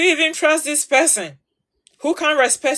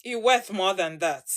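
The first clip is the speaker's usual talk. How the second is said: softer, lower sincere words; tender reassurance to the women watching; 5 semitones lower, 7 dB quieter than usual. Real, not acted. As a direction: comfort her gently. Soft reassurance after urging.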